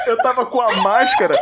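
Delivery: high-pitched, laughing storytelling; laughing while talking. Real, not acted. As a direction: push the words out through laughter. Tells painful story through laughter. (falando no meio da risada, agudo)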